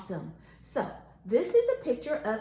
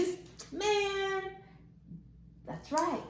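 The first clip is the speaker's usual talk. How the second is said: ordinary talk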